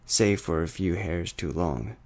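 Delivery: plain reading voice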